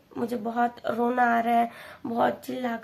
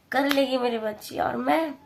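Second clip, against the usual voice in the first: ordinary talk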